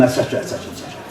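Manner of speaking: whispered and fading; trailing whisper. Real, not acted. Introducing Simon, trailing off in a whisper. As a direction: let it dissolve into a whisper. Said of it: "trailing aside".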